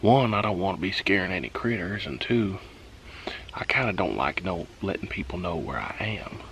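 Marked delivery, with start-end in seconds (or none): 4.8-6.4 breathy hush, no voice; whispering. Real, not acted. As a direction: whisper it so nobody hears. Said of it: "I'm whispering".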